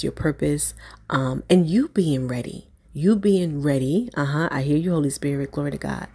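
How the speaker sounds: ordinary talk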